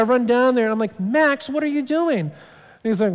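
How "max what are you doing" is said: alarmed dad. Retold or imitated. Dad catching son hitting.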